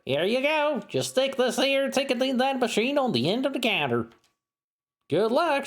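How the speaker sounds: twang it like a cowgirl clerk; Southern clerk. Acted. Clerk character keeps talking.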